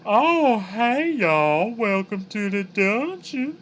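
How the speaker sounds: high, loud, slow southern drawl with an effeminate lilt; Flump voice. Acted. Streamer shows chat his Flump voice for D and D, high camp southern drawl. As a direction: camp monster host drawling a welcome. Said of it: "kinda had a southern drawl".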